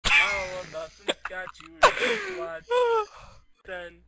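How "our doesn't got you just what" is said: slow, drawn-out villain voice; slow monster boast. Retold or imitated. The Great Mighty Pooh threatens Japan slowly. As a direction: drag each word out like a monster. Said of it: "He's gonna be like".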